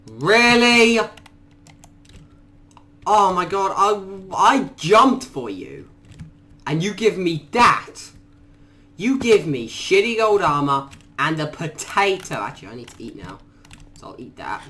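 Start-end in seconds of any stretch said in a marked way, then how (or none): 0.1-1.0 high, loud indignant question; outraged. Real, not acted. Gamer outraged at the gift, loud indignant question. 3.1-12.4 indignant. Real, not acted. Gamer scolding a player over bad loot, mock outrage.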